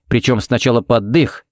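ordinary delivery

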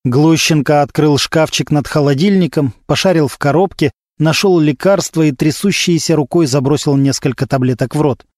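usual speaking voice

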